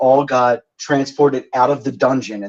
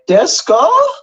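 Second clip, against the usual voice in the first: high, clueless "Desu ka?"; mock bad Japanese; 4 dB louder, slower than usual. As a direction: pretend to be a struggling learner. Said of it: "looking at each other like".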